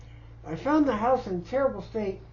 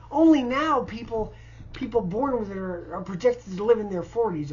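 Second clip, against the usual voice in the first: normal talking voice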